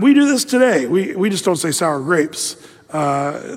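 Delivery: usual speaking voice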